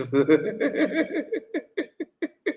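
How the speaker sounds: high, helpless giggling; amused, drawn-out tittering laugh; long laugh. Real, not acted. Amused by the clip.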